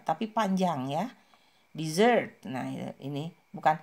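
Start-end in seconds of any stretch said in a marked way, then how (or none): 1.7-2.4 "Dessert" with the second syllable ZERT stressed, falling. Shown as a demonstration. Teacher showing dessert stress against desert. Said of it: "yang ditekan ada suku kata yang kedua".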